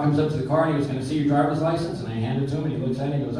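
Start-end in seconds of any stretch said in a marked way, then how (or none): none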